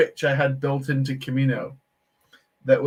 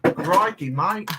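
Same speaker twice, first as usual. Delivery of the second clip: badly faked Aussie accent, higher pitch; hammed-up Aussie lilt, pitched up; Australian accent; 5 semitones higher, slower than usual. Shown as a demonstration. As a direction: have a clumsy go at sounding Australian. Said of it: "my best Australian accent".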